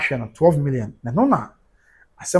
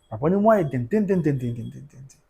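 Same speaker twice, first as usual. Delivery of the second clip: den, den, den dying away to a breath; fading repetition; 4 dB quieter than usual. Real, not acted. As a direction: repeat den and let each one fade to nothing.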